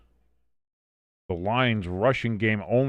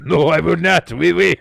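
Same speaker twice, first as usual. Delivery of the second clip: laughing through a hammed-up French accent, higher and louder; laughing mock French; 6 semitones higher, 8 dB louder than usual. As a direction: giggle out "Oui, oui" like a cartoon Frenchman. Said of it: "with a French accent".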